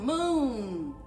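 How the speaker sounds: usual speaking voice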